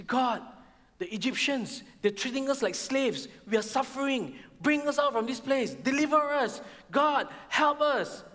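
high-pitched, pleading lament; complaining to God. Acted. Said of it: "They were complaining to God".

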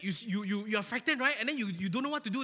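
ordinary talk, nothing marked

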